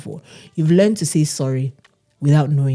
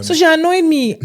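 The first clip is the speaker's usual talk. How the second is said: excited; 12 semitones higher, 5 dB louder than usual. Real, not acted.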